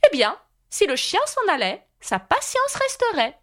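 high-pitched queenly exclaiming; triumphant exclamation. A narrator's reading. Red Queen proving her logic to Alice, high exclaiming voice. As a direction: drive the pitch up as the point is proved. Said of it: "s'exclama la reine".

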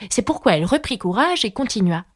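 usual speaking voice

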